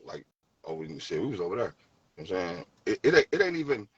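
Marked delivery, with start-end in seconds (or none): 0.7-1.8 "there" low and offhand, kept short; natural local THERE. Shown as a demonstration. Demonstrating everyday hometown talk, "there" said low and unforced. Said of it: "That's how we talk".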